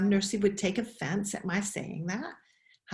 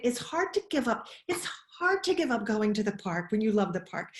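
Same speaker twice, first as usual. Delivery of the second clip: normal talking voice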